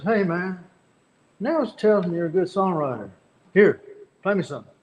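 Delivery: lightly voiced Billy Joe, turned around in the car; Billy Joe talking. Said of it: "all of a sudden he turns around in the car and goes".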